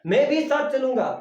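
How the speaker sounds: "भी" पर ज़ोर, ऊँची और तेज़ ज़िद; जिद्दी बच्चे की तरह "भी" दबाओ; insistent child. Retold or imitated. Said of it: "बच्चे कहते हैं ना". (ज़िद करते बच्चे जैसा, "भी" पर ज़ोर)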